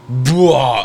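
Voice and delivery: sudden retching "blah"; mock puke splurge; imitated vomiting. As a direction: spew the sound out like throwing up.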